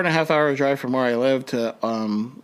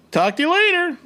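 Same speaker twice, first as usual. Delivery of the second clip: much higher, hurried send-off; rushed goodbye. Retold or imitated. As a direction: toss it over your shoulder, racing off. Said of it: "told my girlfriend".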